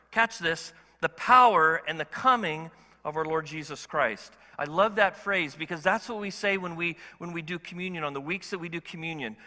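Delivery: usual speaking voice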